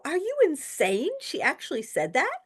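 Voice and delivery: high, incredulous outburst; retold shock. Teacher to student on the phone, high shocked disbelief. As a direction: gasp it out in disbelief.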